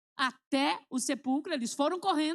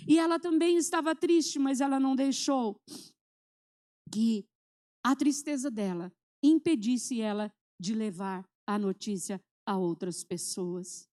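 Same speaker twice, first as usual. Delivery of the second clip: preaching emphasis; 2 semitones lower, 4 dB louder than usual. Acted.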